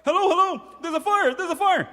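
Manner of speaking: put-on high, loud urgent child calling for help; excited kid on phone. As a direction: shout it into a pretend phone like a panicked kid. Boy calling 911 in game.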